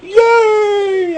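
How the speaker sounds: gleeful yay; excited cheer. Real, not acted. Cheers at being let in.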